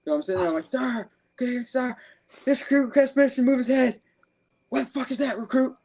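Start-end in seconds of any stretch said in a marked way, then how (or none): 0.7-4.0 shouted recruit address, pitched high; barked sir yes sir. Retold or imitated. Recruit addressing drill instructor. 4.7-5.8 high, harsh drill sergeant yell; drill instructor bark. Retold or imitated. Drill instructor voiced in story.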